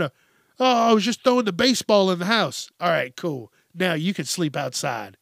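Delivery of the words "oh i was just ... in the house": fast, higher, louder innocent excuse; mock kid excuse. Kid excuses indoor baseball, fast and higher in mock innocence. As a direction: rattle off a kid's lame excuse.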